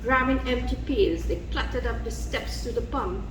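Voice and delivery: plain reading voice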